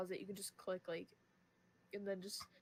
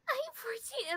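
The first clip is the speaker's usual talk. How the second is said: put-on girlish voice; 4 dB louder, slower than usual. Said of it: "I was like".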